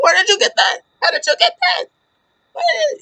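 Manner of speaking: very high, squealed imitation of panic; mock panic. Retelling the manager beside himself over the necklace, mock panic. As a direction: squeal the questions as if beside yourself.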